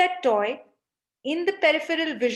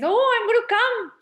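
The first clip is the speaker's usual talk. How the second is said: much higher and louder, bursting with eagerness; an eager kid who can't wait to go; excited child; 11 semitones higher, 6 dB louder than usual. Retold or imitated. Excited child insists on joining mother, high eager burst. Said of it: "the child is very excited and says".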